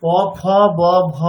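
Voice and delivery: প, ফ, ব, ভ said with both lips pressed shut; teacher contrasting the lip sounds; lip-closed demo. Shown as a demonstration. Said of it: "পার্থক্যটা একটু ভালো করে খেয়াল করি".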